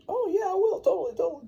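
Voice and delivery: squeaky high, overly agreeable; high falsetto fake promise; high mock-eager voice. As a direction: chirp it like a guilty yes-man.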